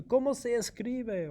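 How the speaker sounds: high, bright teacher asking a question; quiz question voice. Shown as a demonstration. Teacher quizzing a student, high question voice. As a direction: pitch it up like quizzing the class.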